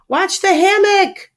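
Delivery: high, stretched-out yell; warning shout. A narrator's reading. Iron Man warns the pig rider, warning shout. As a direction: call a warning slowly and high. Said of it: "shouts Iron Man".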